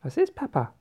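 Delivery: put-on high-pitched child's question. A narrator's reading. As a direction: ask Papa as a startled child. Child asks father in story.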